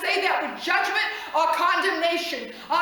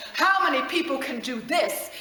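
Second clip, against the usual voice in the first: usual speaking voice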